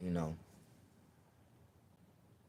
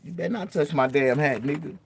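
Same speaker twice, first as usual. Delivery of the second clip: high, loud threat over his hat; loud mock threat; mock warning yell; 8 semitones higher, 8 dB louder than usual. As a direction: warn them off, hammed-up. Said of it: "I'm like".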